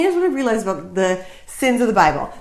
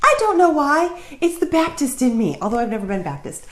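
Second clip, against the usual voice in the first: put-on Southern accent; 2 semitones higher, 3 dB quieter than usual. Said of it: "I must talk in a Southern accent".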